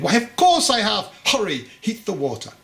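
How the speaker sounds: high, loud burst of good humour, then brisk; a jolly old master bursting into a grin; grinning delight. Acted. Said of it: "broke into a wide grin".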